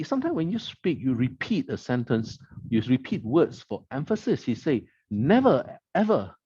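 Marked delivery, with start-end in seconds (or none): 5.1-6.3 each word hit hard and high, falling at the end; emphatic never ever. Retold or imitated. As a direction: stamp down on Never, then on ever. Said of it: "he said with such conviction".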